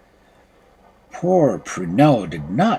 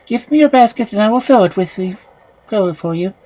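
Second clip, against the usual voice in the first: youth offering; 9 semitones higher, 5 dB louder, faster than usual. A narrator's reading.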